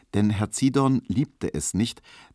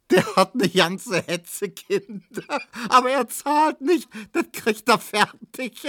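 very high, hysterical jeering; squealing with mirth; laughing hard; 4 dB louder than usual. A narrator's reading. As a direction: squeeze the words out between laughs. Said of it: "Neumann lachte".

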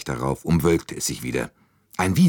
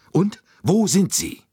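much higher and louder, impatient; eager question; 14 semitones higher, 5 dB louder than usual. A narrator's reading. Bouc eagerly presses Poirot, high and loud. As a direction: demand it eagerly.

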